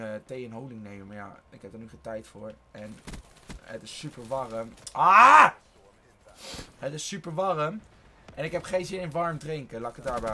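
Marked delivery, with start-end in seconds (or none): 4.9-5.5 short, high yelled "ah"; loud yell. Real, not acted. Gamer cut off mid-sentence by the game, loud yell.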